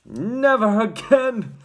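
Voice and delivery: loud, high, drawn-out shout; angry father's slow shout. A narrator's reading. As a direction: bellow it with finality. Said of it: "shouted Mister T V".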